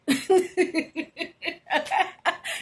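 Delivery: light, airy laugh; real laugh. Real, not acted. Laughing at her slang examples for viewers, light laugh. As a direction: let a light laugh roll.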